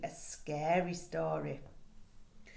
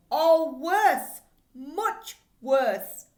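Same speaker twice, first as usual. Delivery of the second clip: higher and louder, pressing the horror; building dread; 6 semitones higher, 9 dB louder, much slower than usual. Acted. Teacher reads the spooky blurb to children, louder and higher. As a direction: ramp up the creepy tension.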